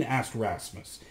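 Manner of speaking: ordinary delivery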